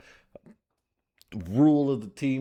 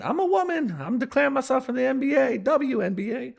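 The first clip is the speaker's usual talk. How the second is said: mock woman voice; 6 dB louder than usual. Comic claiming to be a woman.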